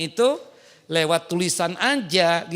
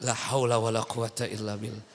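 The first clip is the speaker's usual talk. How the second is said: exasperated sigh; 8 semitones lower, 6 dB quieter than usual. Real, not acted. Exasperated exclamation.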